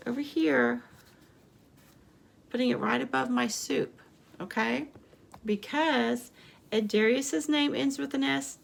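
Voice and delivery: ordinary talk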